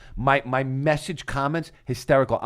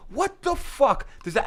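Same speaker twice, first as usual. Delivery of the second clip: much higher, slow incredulous outburst; outraged disbelief; 12 semitones higher, slower than usual. Retold or imitated. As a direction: stretch it out in stunned disbelief. Retold outrage at date.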